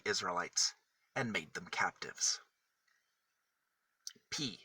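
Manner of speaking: gentle storybook reading, letter by letter; reading to children. Acted. Matthew reading an alphabet book to kids, gentle storybook voice. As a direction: read the alphabet book aloud to little kids.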